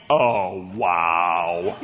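deep, drawn-out wow; awestruck. Retold or imitated. Preacher in line hearing the big guy, awestruck. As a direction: let it sink very low in amazement.

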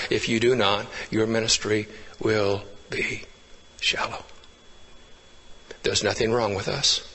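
hushed close. Real, not acted. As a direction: draw them in as the sermon fades out. Sermon closing, voice sinking to hush.

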